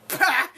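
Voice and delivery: loud high "pah" burst; mock outburst. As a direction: burst out in exaggerated disbelief. Imagined crowd reacting in shock.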